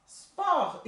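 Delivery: teacher stressing silent T; sport without T. Shown as a demonstration. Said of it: "Ainda não pronuncia o T".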